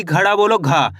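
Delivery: ordinary talk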